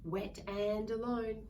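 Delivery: ordinary delivery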